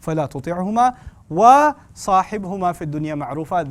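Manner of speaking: quick melodic Arabic recitation; Quran recitation. Acted. Preacher opening with the verse, recited fast.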